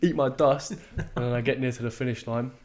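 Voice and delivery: usual speaking voice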